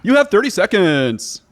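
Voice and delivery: loud, high quiz host voice; game show announcer. Acted. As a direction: rattle off the rules like a quiz host.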